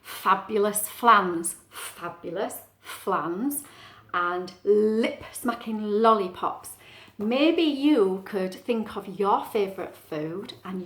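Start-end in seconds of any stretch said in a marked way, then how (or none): 0.0-3.6 slow "Fabulous flans" with leaning initial F; "Fabulous flans" said slowly to show the F; alliteration demo. Shown as a demonstration. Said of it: "something called alliteration going on". 4.6-6.8 slow "lip-smacking lollipops" with lingering L; "lip-smacking lollipops" said slowly to show the L; alliteration demo. Shown as a demonstration. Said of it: "something called alliteration going on".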